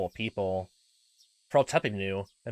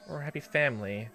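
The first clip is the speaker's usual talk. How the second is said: small, higher, meek voice; submissive girl; 4 semitones higher than usual. Acted. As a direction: parrot it back obediently. Frightened girl parrots her uncle.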